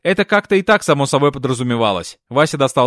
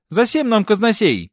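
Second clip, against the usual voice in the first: mild lisping protest, pitched higher; slow, higher, put-out objection; indignant; 6 semitones higher than usual. A narrator's reading. Comrade sees no need for a treasurer, mildly indignant. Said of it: "возмутился короток".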